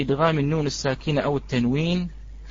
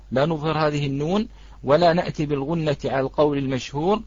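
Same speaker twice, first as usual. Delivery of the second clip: normal talking voice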